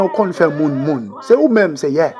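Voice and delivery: usual speaking voice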